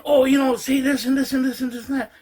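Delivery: mild impression of an eager nephew; nephew's eager instructions. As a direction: rattle off directions like the nephew did. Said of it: "he starts saying".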